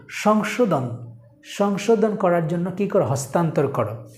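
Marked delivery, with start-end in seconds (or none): none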